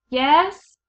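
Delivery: high, loud eager yes; loud high question; shouted. A narrator's reading. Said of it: "shouted the pea".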